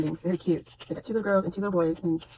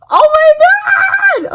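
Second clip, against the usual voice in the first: very loud, high outburst; excited scream; 14 dB louder, faster than usual. Real, not acted. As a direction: scream it out, carried away with delight. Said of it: "I'll mute myself on this".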